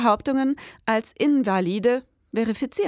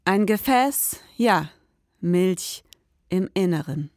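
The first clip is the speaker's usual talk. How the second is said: slow, low, dreamy narration; slow story narration; 4 semitones lower than usual. A narrator's reading. Detective narrating the strange visitor, slow and dreamy. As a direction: linger on each image, savouring it. Narrator introduces milk jug.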